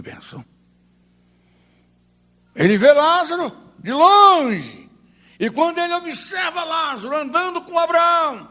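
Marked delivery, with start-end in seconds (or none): 2.5-8.5 high, drawn-out pulpit delivery; impassioned preaching. Real, not acted. Preacher telling how the rich man sees Lazarus afar, impassioned. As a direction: stretch it out in a raised preacher's pitch.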